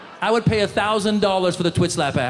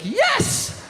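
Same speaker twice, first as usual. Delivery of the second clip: high, sudden cheer of relief; excited yes. Retold or imitated. Comic relieving the news: a high joyful yes. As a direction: celebrate like your prayer was answered.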